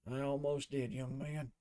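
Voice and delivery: quiet rueful amusement; a hint of a battered mentor; wry instructor. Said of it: "looks up at him and says".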